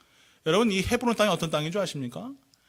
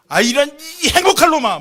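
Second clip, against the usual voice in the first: high, loud, put-on joyful cry; overjoyed blessing; 9 semitones higher, 11 dB louder than usual. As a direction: bless the child with a happy shout. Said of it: "막 이러면서 여러분, 축복하세요".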